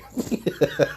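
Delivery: soft laugh. Real, not acted. Laughs at own remark.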